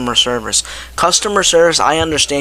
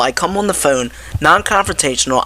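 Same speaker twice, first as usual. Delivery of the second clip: usual speaking voice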